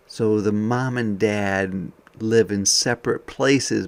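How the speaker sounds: plain reading voice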